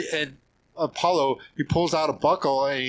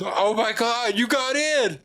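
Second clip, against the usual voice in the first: imitating a thrilled friend, much higher; amazed delight; 10 semitones higher than usual. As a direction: gasp out the good news. Retold Paulo hearing news.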